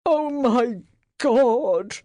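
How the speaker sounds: slow, loud anguished moan. A narrator's reading. Master finding his dead dog, moaning. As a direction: let the grief break out slowly. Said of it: "Spiers moaned".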